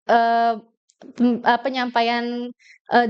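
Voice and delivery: normal talking voice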